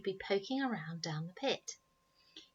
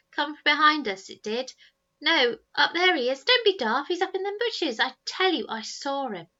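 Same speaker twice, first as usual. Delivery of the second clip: put-on arguing boys; 7 semitones higher, 10 dB louder than usual.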